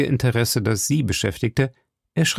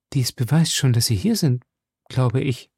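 ordinary delivery, nothing marked

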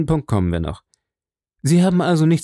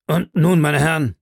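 firm, higher-pitched call to order; 4 semitones higher, 3 dB louder than usual. A narrator's reading. Baronet demands an explanation from the gentlemen, in a firm tone. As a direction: take charge, mildly assertive. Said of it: "sagte der Baronet in bestimmtem Ton".

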